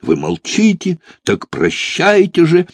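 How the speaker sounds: high, theatrical farewell wail; false despair. A narrator's reading. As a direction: bid a hammy tragic goodbye, drawing it out. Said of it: "С фальшивым отчаянием возопил молодой человек".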